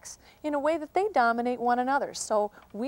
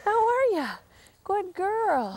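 much higher cooing to a wolf; high-pitched greeting; 7 semitones higher, much slower than usual. Real, not acted. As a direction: coo it high like a whine. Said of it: "my voice is raised in pitch".

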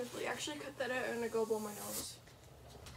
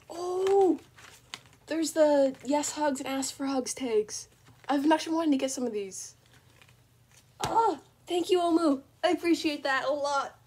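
excited; 4 semitones higher, 10 dB louder than usual. Real, not acted. Thrilled unboxing thanks.

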